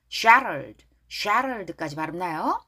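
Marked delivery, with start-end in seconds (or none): 0.1-0.8 "shattered" with the t flapped like a quick r; softened t. Shown as a demonstration. Teacher modelling the t between vowels in "shattered". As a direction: say "shattered" as "sharrered". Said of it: "T가 모음사의 shattered".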